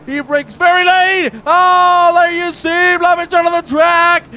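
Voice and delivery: high, building to loud shouts; excited play-by-play. Real, not acted. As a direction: ride the overtake up to a yell. Commentator calls a heated overtake.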